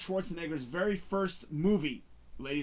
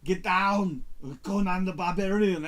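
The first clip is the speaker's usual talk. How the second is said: Arnold impression; 4 dB louder than usual. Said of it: "so I will do it for you".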